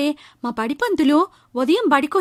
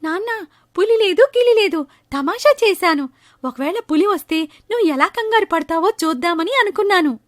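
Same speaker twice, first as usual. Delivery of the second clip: put-on higher, teasing kid voice; cheeky boy; 7 semitones higher than usual. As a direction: laugh off the prank as a child. Boy confesses prank.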